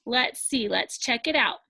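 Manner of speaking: normal talking voice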